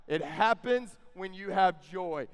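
ordinary delivery

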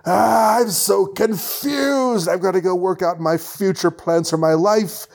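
confused villain. Acted. Kraven's confused exit line.

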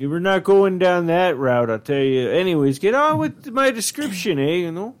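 hammed-up Canadian accent with Irish lilt. Co-host introduces self as Canadian fairy, Canadian Irish accent. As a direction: play a folksy Canadian fairy. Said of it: "It's the Canadian".

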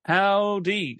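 drawled cowboy howdy; Southern howdy. Shown as a demonstration. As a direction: try a Texan greeting. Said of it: "I wanted to try that, but it just doesn't sound right with my voice".

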